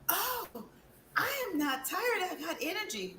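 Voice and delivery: high, bright surprised exclaim; energized client. Retold or imitated. Client describing her detox results, high and excited. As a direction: exclaim it like you just woke up full of energy. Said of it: "And then you're like".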